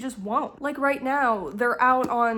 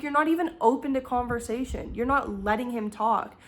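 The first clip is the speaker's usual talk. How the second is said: ordinary delivery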